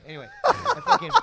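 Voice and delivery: high burst of laughter mid-sentence; real laughter. Real, not acted. Telling friends the pistol story, laughing. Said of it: "bursts out laughing".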